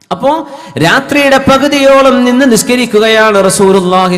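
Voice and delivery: preaching. Acted. Preacher telling congregation of the night prayer, preaching. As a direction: project it to a congregation, phrase by phrase.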